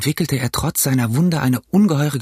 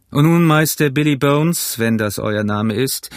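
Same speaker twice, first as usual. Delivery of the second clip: firm doctor, raised then slowing aside; brusque doctor; 3 semitones higher than usual. Acted. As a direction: address the patient with brisk authority. Doctor figure addresses patient. (resoluter Doktor spricht den Patienten an)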